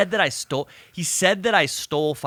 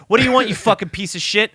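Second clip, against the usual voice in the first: fast, louder and higher furious snarl; imitated angry yell; 8 semitones higher, 6 dB louder, faster than usual. Dad cursing out the phone thief, fast mock rage. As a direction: spit the insult at him.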